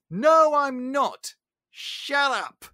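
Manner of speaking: high, loud, slow put-down; sharp rebuff. Retold or imitated. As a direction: shut him down hard. Belle rebuffs Gaston.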